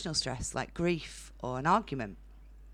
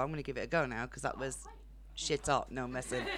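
ordinary talk

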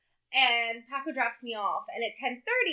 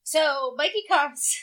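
ordinary delivery

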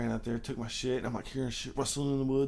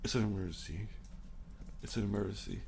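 softer, lower pleading; hushed low repeated plea; desperate plea; 4 semitones lower, 9 dB quieter than usual. Retold or imitated. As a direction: plead it quietly, lower, urgent. Retold desperate plea to friend.

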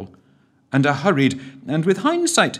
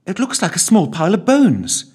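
high, loud discovery cry; excited exclamation; 10 semitones higher, 6 dB louder than usual. A narrator's reading. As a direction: blurt it out, thrilled. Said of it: "she exclaimed".

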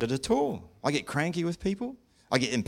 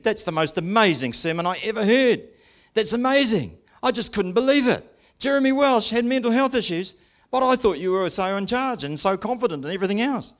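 high, louder retold astonishment; amazed mate; 7 semitones higher, 4 dB louder than usual. Preacher quoting his mate after the sermon, amazed. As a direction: act out a friend who can't believe it.

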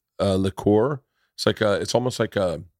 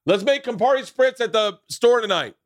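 excited call; 12 semitones higher than usual. Real, not acted. Calling out to his friend.

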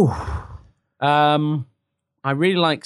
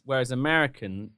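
usual speaking voice

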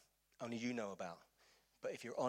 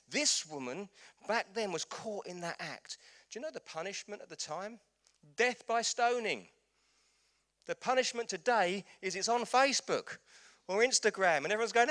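full-force preaching; 11 semitones higher, 11 dB louder than usual. Acted. Pastor to church, impassioned full-force preaching. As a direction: hammer each point home.

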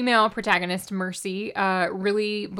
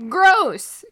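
loud high cry of Gross; disgusted shout; 10 semitones higher, 10 dB louder than usual. Real, not acted. Exclaims disgust at book.

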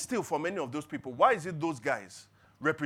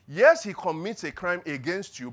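normal talking voice

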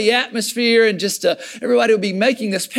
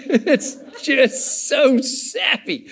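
laughing; 6 semitones higher, much slower than usual. Real, not acted. Laughing at a sappy song.

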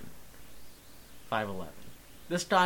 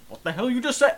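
mock-angry retort, higher and louder; mad guy; 8 semitones higher, 5 dB louder, faster than usual. Customer challenging the person who asked about a mask, mock angry. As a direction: play an offended customer confronting someone. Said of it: "be really mad at me".

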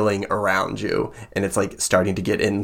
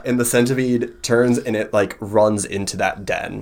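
usual speaking voice

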